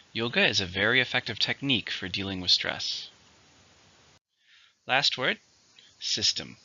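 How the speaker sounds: ordinary delivery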